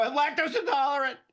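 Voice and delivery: high, loud, panicked protest; desperate yell. Retold or imitated. Suspect explaining his pill to cops, desperate yell. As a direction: cry it out in your own defence. Pleading with armed police.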